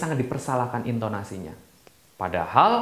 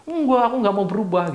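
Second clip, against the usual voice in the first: defiant refusal; 9 semitones higher than usual. Retold or imitated. Stubborn young self refusing change.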